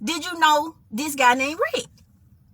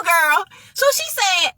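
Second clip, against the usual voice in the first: ordinary talk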